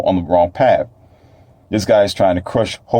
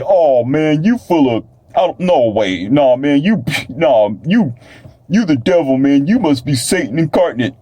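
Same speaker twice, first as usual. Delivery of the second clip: higher-pitched imitation of an indignant doubter; hammed-up accusing objector; mock disbelief; 6 semitones higher than usual. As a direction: act out someone protesting in stumbling disbelief. Acts out listeners rejecting his message.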